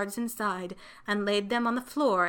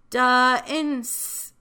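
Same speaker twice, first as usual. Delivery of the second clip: higher, halting early reader's attempt; sounding out; 4 semitones higher, 5 dB louder than usual. A narrator's reading. Child puzzling over letters on cards, sounding out. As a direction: sound it out syllable by syllable, drawn out.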